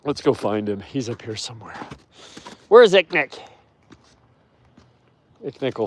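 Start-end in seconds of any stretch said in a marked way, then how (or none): 2.7-3.4 loud high call across the enclosure. Real, not acted. Owner calling out for her wolf.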